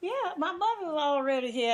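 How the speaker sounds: ordinary talk, nothing marked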